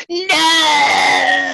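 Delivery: high anguished cry; shouting while wounded. Acted. Darkness cries out in pain.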